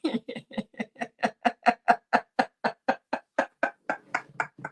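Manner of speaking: quiet wheezing laugh; breathy laugh. Real, not acted. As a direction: laugh silently under the breath. Laugh after a joke.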